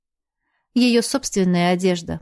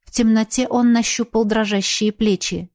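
ordinary delivery, nothing marked

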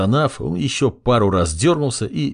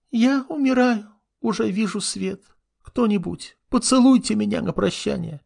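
high, hammed-up dying plea; milk the fake death for sympathy; dying girl; 13 semitones higher than usual. A narrator's reading. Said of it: "imp fakes dying again".

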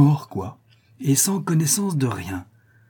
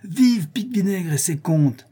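cheer; 6 semitones higher than usual. A narrator's reading. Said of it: "dit un autre".

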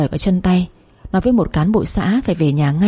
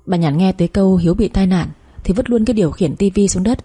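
usual speaking voice

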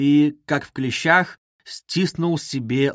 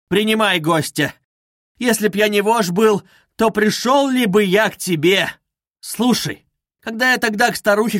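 higher and louder, bitter and pressing; agitated confession; 5 semitones higher, 4 dB louder than usual. Acted. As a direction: pour it out, voice climbing. Исповедь, голос взлетает. (взволнованно, всё выше и громче)

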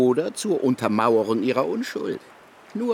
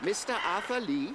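incredulous question; 10 semitones higher, 8 dB quieter than usual. Acted. Investigator repeats the alias.

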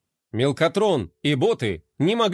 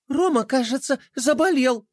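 higher, worried princess voice; 9 semitones higher than usual. A narrator's reading. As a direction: break the sad news. Pinky voice. (высокий встревоженный голос принцессы)

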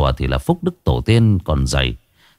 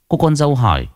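plain reading voice

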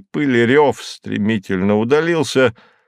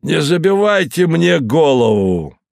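angry; 7 semitones higher, 5 dB louder than usual. A narrator's reading. Moses angry at host.

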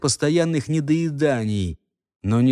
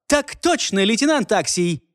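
a hint of a snappy private; crisp soldier reply; 7 semitones higher than usual. A narrator's reading. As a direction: snap it back to the officer. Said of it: "отчеканил он устойчивым тоном".

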